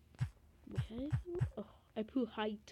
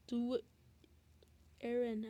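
normal talking voice

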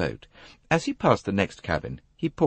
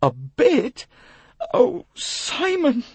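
high, wailing plea, drawn out; distraught young woman; 5 dB louder, much slower than usual. Acted. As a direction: cry out his name in anguish. Distraught Jackie, acted in story.

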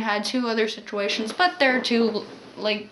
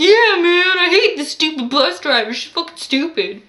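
put-on higher, louder drunk yelling into phone; drunk man yelling; 7 semitones higher, 6 dB louder than usual. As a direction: shout like a drunk storming off. Drunk man screaming leaving bus.

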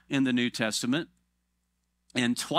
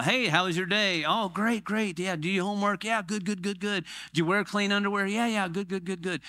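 higher, hurried mock-parent check-in; pit stop parent; 7 semitones higher than usual. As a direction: rattle through the questions without listening. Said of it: "doing pit stop parenting".

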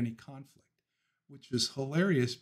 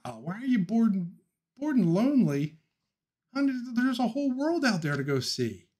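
mock sing-song; 9 semitones higher than usual. Mock sing-song reading a comment.

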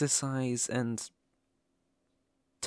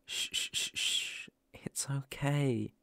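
soft slow whispered shh; soothing shushing; 2 semitones higher, 4 dB quieter, slower than usual. Acted. Hugging the crying werewolf, whispered shushing. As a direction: hush them like a crying child.

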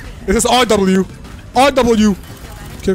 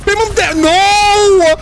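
frantic yell; 11 semitones higher, 4 dB louder, much slower than usual. Real, not acted. Urging then losing the fight.

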